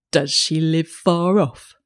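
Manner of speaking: lightly voiced wolf, slow; a hint of a sly creature drawing out a question. A narrator's reading. Wolf questioning the child in the wood, slow wolf voice. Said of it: "said the wolf".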